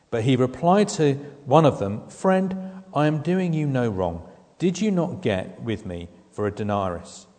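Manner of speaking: loud, earnest reading of the master's reply; preacher voicing the master's reply; scripture reading. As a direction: read the reply firmly.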